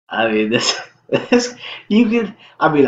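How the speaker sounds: high, loud laughing burst; breaking into laughter. Real, not acted. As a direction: cracks up mid-sentence. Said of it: "I'm almost laughing right now".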